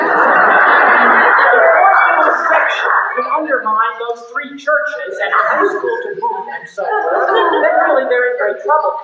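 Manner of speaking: rising to a laugh of disbelief; laughing incredulity. Acted. Mocking the Neo-Puritans' picket argument, laughing in disbelief.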